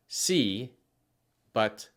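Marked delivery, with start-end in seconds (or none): 0.1-0.7 "sí" drawn out like English see; anglicized si. Shown as a demonstration. Teacher showing the version to avoid. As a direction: say "sí" the wrong English way.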